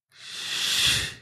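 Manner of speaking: breathy unvoiced shushing; gentle unvoiced shh; soft shush. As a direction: hush yourself softly in the dark. Shushing in dark hotel room.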